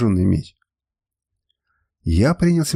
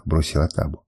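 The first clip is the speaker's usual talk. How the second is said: plain reading voice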